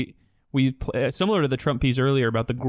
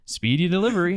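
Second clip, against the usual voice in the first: higher, cheery mailman catchphrase; Mister Rogers mailman; 6 semitones higher than usual. Retold or imitated. Joking about Mister Rogers, quoting the mailman's cheery line. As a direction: call it out like the TV mailman at the door. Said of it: "like Mister Rogers, where the mailman shows up".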